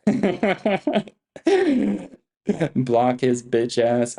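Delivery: cracking up into the words; real laughing. Real, not acted. Streamer blocking a chat critic, laughing. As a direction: laugh first, then slow the words down.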